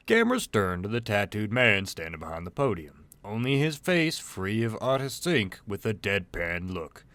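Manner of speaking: ordinary delivery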